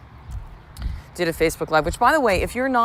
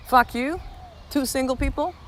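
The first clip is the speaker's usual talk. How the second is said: higher mock snap at strangers; retold telling off; 8 semitones higher than usual. Mother venting at childless travelers, retold telling off. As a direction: tell them off, half laughing. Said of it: "was like".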